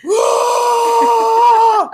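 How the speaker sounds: high piercing mock scream of pain; a sharp, loud, hammed-up cry of shock; retold scream. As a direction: yelp it out as the acid burns your eye. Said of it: "I'm screaming bloody murder".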